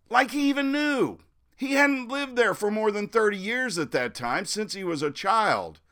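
high-pitched scoffing protest; indignant scoff. Real, not acted. Local disputing Cronkite's claim to know, indignant scoff.

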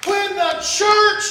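loud, building preacher cry; preaching at full force. Acted. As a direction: rouse the church to its feet.

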